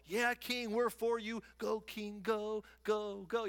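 put-on cheering chant. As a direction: play a crowd of yes-men rooting for the king. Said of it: "they're all cheering him on".

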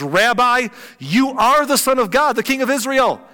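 loud, high-pitched excited declaration; exclaiming. Acted. Nathanael recognizing who Jesus is, exclaiming. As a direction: proclaim it with sudden conviction. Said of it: "Then Nathanael exclaimed".

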